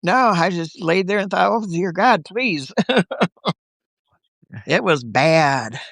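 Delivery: ordinary delivery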